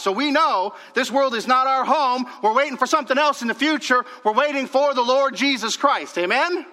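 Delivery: high, loud, rallying proclamation; full-force preaching. Real, not acted. Preacher rallies the church to await Christ, forceful preaching. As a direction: press it home and call for an amen.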